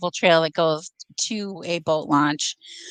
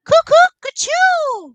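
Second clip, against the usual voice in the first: high, loud, slow cuckoo yell; yelled cuckoo call; 7 dB louder, slower than usual. Retold or imitated. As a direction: call out cuckoo to catch a prankster. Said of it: "yelled it out".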